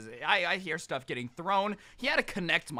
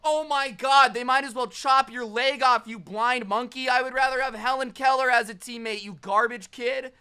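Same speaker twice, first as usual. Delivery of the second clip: loud, high, mocking trash talk; mock trash-talk yell; 7 semitones higher, 8 dB louder than usual. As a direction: yell insults like a toxic gamer. Trash talk yelled at teammate.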